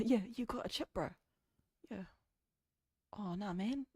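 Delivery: casual, exaggerated New Zealand accent; lightly put-on broad Kiwi accent; thicker Kiwi accent. Shown as a demonstration. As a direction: lay on the Kiwi slang accent. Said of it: "make my Kiwi accent thicker".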